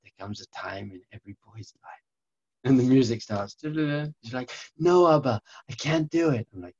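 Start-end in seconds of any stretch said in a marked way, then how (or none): 0.0-2.0 mock-solemn dad delivering the big moment; Hollywood movie solemnity. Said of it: "it's like a, a Hollywood movie". 4.8-6.5 high, loud mock child's protest; protesting little boy. Son protests in dramatic retold scene.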